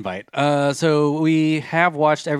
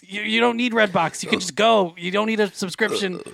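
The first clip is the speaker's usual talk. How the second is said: Rick voice; 7 semitones higher than usual. Pretend. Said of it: "Why do you keep".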